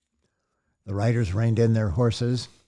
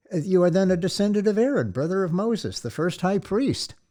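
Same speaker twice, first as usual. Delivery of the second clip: curious wonder; 9 semitones higher than usual. Acted. Big man questions lineage.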